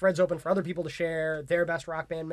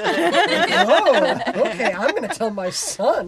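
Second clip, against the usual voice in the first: higher, louder excited lady voice, laughing; starstruck lady; 7 semitones higher, 12 dB louder, much slower than usual. Retold or imitated. Imitating the woman on the bus who believed they were Green Day, higher and gushing. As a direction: gush like a fan who believes it.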